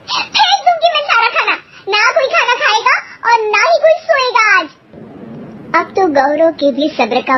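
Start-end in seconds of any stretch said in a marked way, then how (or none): 0.1-4.7 much higher, tearful furious yell; angry shout. Acted. Wife screams at husband over dinner, furious and tearful. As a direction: threaten to throw the food away. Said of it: "वो जोर जोर से चिल्लाने लगती है".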